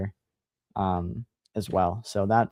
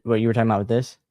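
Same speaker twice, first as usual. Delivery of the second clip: ordinary delivery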